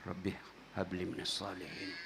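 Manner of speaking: put-on very soft, low whisper into a child's ear; hushed prayer whispered to a nephew. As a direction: breathe the prayer right into his ear. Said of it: "Saya bisik telinganya".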